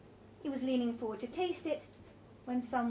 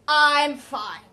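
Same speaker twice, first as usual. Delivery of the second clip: very loud, higher, emphatic; raised, brusque voice; insistent assurance; 4 semitones higher, 15 dB louder than usual. A narrator's reading. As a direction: insist on it forcefully. Roger insists he is fine.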